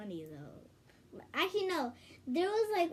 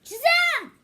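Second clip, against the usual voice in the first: high, loud magic-word cry; shouted hero cry; 17 dB louder than usual. Pretend.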